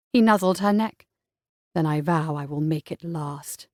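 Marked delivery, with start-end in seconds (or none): 1.7-3.7 soft low vow; low tender vow. A narrator's reading. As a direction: promise her tenderly. Voices the hero.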